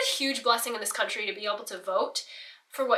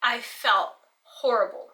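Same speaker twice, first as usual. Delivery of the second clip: ordinary talk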